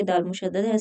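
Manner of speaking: ordinary talk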